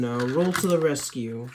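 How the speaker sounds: normal talking voice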